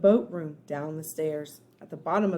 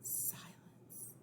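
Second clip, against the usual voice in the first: hushed; 27 dB quieter than usual. A narrator's reading. Narrator hushes in tomb.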